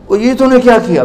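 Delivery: high, shocked outcry; mock shock of a ruined scholar; shocked cry. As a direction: cry out in disbelief. Scholar shocked at drowned books.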